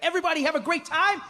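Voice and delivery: high, gleeful tour-host voice; rally the crowd for a surprise; hammed-up delighted host; host having a blast. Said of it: "he's having a blast".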